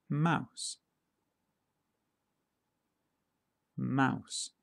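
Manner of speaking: each pet name said clearly on its own for learners to repeat; slow, careful dictation of each word for practice; careful word drill. Shown as a demonstration. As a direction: model British pronunciation of each word, with a pause after it. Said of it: "I'm going to say each one twice".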